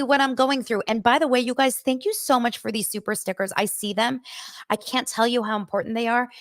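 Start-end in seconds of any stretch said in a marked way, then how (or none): none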